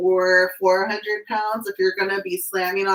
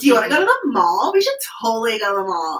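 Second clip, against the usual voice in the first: put-on fast, higher, louder Valley girl mall-rat voice; 3 semitones higher, 5 dB louder, much faster than usual. Imitating her younger self inviting friends, Valley girl voice. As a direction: rattle it off like an eager teen begging. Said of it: "why I talk like a Valley girl sometimes".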